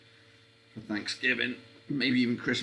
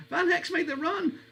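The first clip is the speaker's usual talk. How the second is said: excited call. Real, not acted. Repeated, rising to excited shout.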